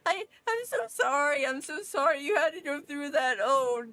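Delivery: high, tearful pleading sorry; crying apology. Acted. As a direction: sob it out while hugging a friend. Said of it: "I just cry".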